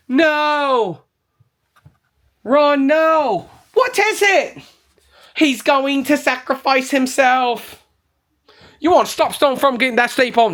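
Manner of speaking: high-pitched mock-desperate film character; acted movie quote. Reviewer quotes a movie for viewers to guess, high mock-dramatic cries. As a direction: cry it out like a film's dramatic climax, hammed up. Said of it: "what movies that's from".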